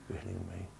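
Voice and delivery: "You're hitting me" dropped to a near whisper; quiet, intimate echo of "You're hitting me"; hushed repeat. Shown as a demonstration. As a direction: let "hitting" sink to almost nothing.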